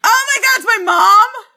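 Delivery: high, loud astonished cry; real burst of disbelief; excited surprise. Real, not acted. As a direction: shriek it, thrilled at the winner. Giveaway winner is her own mother.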